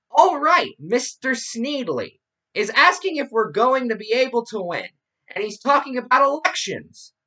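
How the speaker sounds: high, loud mocking radio-host voice; Rush Limbaugh parody. Podcast host reading out a radio host's transcript, mocking his voice. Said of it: "making fun of his voice".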